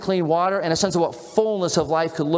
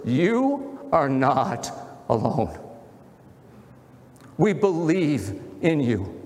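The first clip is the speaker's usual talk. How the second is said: sincere reassurance; 3 semitones lower, slower than usual. Retold or imitated. Pastor quoting the cyclist's words to him, slow and tender. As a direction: let each word land gently, like a blessing. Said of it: "he used these words".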